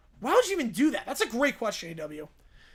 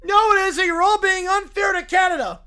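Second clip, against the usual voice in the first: indignant shout; 4 semitones higher, 8 dB louder than usual. Acted. Defending Canada indignantly.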